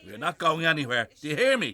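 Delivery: loud, high defiance; defiant shout. Acted. Old man refusing the bailiff's orders, defiant shout.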